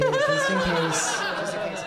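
squeaky joke voice. Acted. Joking about the Lactaid.

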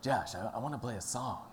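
lightly imitated Rodney Dangerfield accent; a hint of a Rodney Dangerfield accent, mildly mimicked. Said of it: "like a Roger Dangerfield kind of accent".

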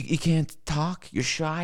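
ordinary talk

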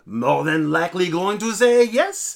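a light, put-on French accent. Co-host voicing a French listener's review in a light French accent. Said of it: "he is French".

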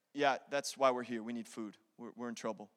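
low, hushed, timid answer; meek plea. Acted. Brothers admitting trouble to Joseph, meekly. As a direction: plead very softly, faltering slightly.

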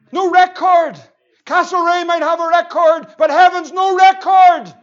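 high, loud preaching on record; emphatic preaching shout. Real, not acted. Preacher proclaiming to the congregation, high loud shout. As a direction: drive the word record home, loud.

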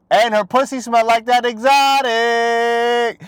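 high, slow, drawn-out rap quote with hyped ad-libs; squeaky hype-man ad-libs climbing to a growl. Retold or imitated. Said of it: "Young Ma told you".

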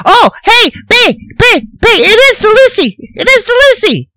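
high, loud sitcom caller; hammed-up high sitcom voice. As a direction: call out in a high comic voice. Lucy show lines acted.

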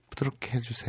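very soft request; gentle murmur as the story's woman; acted soft plea. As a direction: say it hushed and intimate. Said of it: "딱 얘기를 한 거예요".

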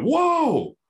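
high, loud gasp of wonder; a sudden astonished whoa; startled whoa. Retold or imitated. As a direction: blurt it out in shock. Coming out of a trip, exclaiming.

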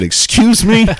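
high, loud outraged "excuse me"; teacher caught off guard, indignant; shocked teacher. Retold or imitated. Teacher shocked by student's insult.

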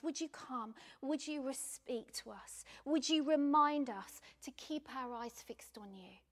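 ordinary delivery

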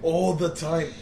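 quick, run-together Scottish accent demo. Shown as a demonstration. Showing how the Scottish go around talking, Scottish accent. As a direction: race through it in a thick Scottish accent. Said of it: "the Scottish just go around talking like this".

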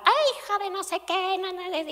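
high-pitched imitation of a mother yelling; mock yelling mom. Mother in a TikTok yelling at her kids, high mock yell. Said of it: "una mamá que gritaba".

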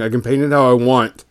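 ordinary delivery

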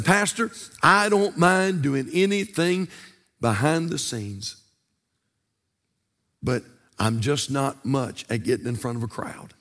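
low, hushed imitation of a reluctant volunteer; reluctant church member. Church member declining the pastor's invitation to preach, quietly reluctant. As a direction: back away from the offer, dropping very low and soft. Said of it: "They'll say".

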